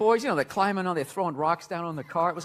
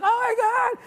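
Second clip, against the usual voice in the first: very high-pitched cooing delight; hammed-up adoring exclamation; mock gushing; 4 dB louder than usual. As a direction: squeal over the baby like party guests. Acts guests gushing over baby.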